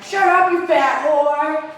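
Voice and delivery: mock sibling yell, high and loud; retold yell. Boy fighting sister in the kitchen, mock yelling. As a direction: yell it drawn out, as in a childhood fight. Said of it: "I yelled at my sister".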